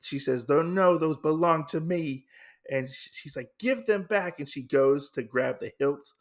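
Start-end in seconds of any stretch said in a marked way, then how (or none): 0.4-4.4 claw back what is hers; furious sister. Retold or imitated. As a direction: sister lunging for the swords. Sister lunges for brother.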